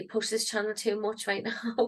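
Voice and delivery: normal talking voice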